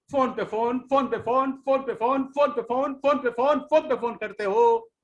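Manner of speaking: exasperated imitation of constant calling; mocking exasperation. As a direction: pile on phone पे phone, climbing in pitch each time. Mocking endless nagging phone calls.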